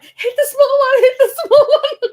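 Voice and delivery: high, loud urgent yell at the driver; panicked yell. Retold or imitated. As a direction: shout it fast and high, pleading to aim for the small one. Yelling at driver about kangaroos.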